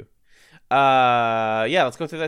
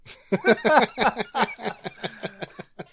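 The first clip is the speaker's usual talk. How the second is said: loud laugh; 12 semitones higher, 5 dB louder than usual. Real, not acted. Laughing at own deletion.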